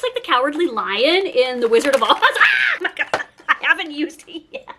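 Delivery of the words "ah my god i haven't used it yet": real giggle; laughing. Real, not acted. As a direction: burst of high giggly laughter. Laughing at how she looks.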